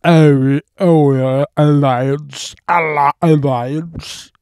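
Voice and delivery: slow loud drawn-out alliance; mimicking the professor. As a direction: ham up his villain drawl. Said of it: "and it'll be".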